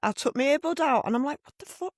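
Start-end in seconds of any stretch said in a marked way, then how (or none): 1.4-1.9 high, hushed mock shock; retold disbelief. Woman retelling her reaction to a shouting stranger, disbelieving. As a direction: act out a stunned, softer reaction.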